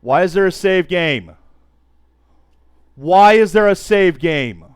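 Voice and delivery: loud, high disbelieving yell; shrieking jackpot winner. Real, not acted. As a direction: shout it up at the sky. Baffled yell after the finale.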